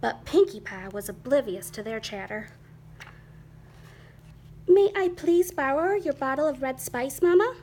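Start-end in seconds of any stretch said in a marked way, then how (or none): 4.7-7.5 higher, lifting politely on the last word; polite request. A narrator's reading. Kitty boy asking Mama for a favor, polite. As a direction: ask ever so nicely, pitched up. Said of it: "he asked politely".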